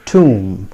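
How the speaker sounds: "tomb" as "toom", silent b; low falling "tomb" with long oo; careful "tomb", ending on m; correct pronunciation. Shown as a demonstration. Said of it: "It is pronounced as".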